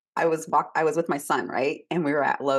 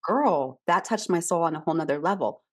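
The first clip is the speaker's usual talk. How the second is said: ordinary delivery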